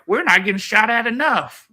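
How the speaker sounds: high, mocking eager voice; mock their eagerness, up high; cocky soldiers. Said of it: "they're like".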